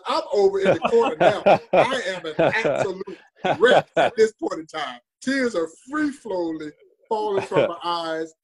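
laughing as you confess you were a wreck; a real, rueful laugh running through the words; laughing through emotion. Real, not acted. Laughing while telling moving story.

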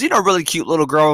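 normal talking voice

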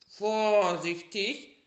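slow, high, loud drawn-out warning; little bear's careful plea. A narrator's reading. Little bear warning the carriers, loud and slow. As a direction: call out slowly so they take care. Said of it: "sagte der kleine Bär".

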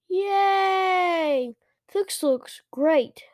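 high, loud, drawn-out cheer; excited exclamation. A narrator's reading. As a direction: cheer like a thrilled kid. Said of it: "Luke exclaimed".

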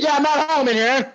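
mock oblivious caller; clueless caller. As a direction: announce yourself, unaware. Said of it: "repeat of caller".

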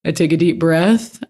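usual speaking voice

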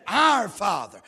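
loud "Our Father" with "Our" punched; stress on OUR. Shown as a demonstration. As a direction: preach "Our" as the key word. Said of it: "he said".

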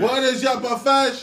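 mock battle cry, high and loud; hammed-up warrior rallying call; movie warrior leader. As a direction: call it out like a commander to troops. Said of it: "you ever seen a movie three hundred".

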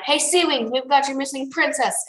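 half-call out across the water; mock shout. A narrator's reading. Glory imagines hailing the Sea Wings, mock shout. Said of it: "Should we shout".

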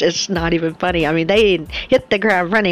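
ordinary delivery

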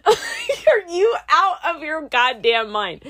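high, loud, drawn-out disbelief; hammed-up outrage breaking out of a laugh; laughing incredulity. Student reacting to flying with a headlamp, loud incredulous outburst.

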